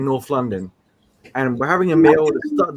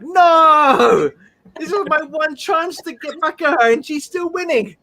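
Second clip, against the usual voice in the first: indignant protest; 9 semitones higher than usual. Retold or imitated. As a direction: insist you're the real victim here. Protesting fans calling him monster.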